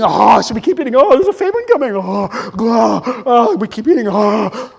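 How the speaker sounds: loud gobbling between phrases; panicked gorging. Acted. Humans told a famine is coming, loud frantic gobbling. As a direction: gobble noisily in a mock panic.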